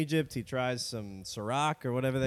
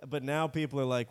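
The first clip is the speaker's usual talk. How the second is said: ordinary talk, nothing marked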